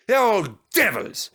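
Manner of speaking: mildly fierce growl; a touch of fierce anger. Acted. Douglas fiercely cursing the Diesels. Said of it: "he added fiercely".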